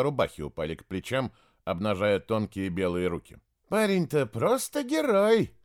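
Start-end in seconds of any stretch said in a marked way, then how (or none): 3.7-5.5 high, slow and lilting; sing-song. A narrator's reading. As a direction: sing it out like telling a fairy tale. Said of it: "Её голос прозвучал певуче".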